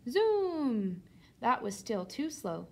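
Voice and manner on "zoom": drawn-out zoom, falling away; zooming noise. A narrator's reading. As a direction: imitate a chair picking up speed. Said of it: "put it in tenth gear".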